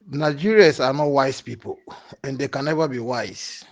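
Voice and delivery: normal talking voice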